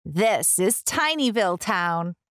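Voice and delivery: plain reading voice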